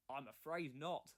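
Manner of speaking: ordinary delivery, nothing marked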